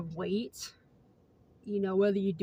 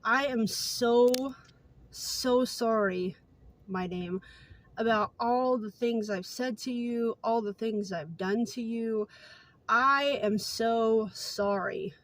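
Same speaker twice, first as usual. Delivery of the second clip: slow mock apology; much slower than usual. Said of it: "he's looking at me like".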